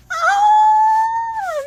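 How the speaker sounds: long, very high, loud squealed oh; fangirl squeal. Real, not acted. Fan imagining a favourite teacher comforting a student, excited squeal. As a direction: squeal it out with delight. Fan reacting to a character match.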